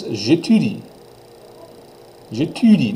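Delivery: j'étudie with a French u; teacher demo; French pronunciation. Shown as a demonstration. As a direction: model j'étudie. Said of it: "the U sound is a new sound".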